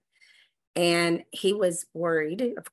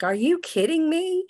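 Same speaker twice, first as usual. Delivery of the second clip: amazed disbelief; 9 semitones higher than usual. Retold or imitated. Husband hearing about the money, high astonished voice. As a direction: play the husband floored by the news. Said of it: "He's like".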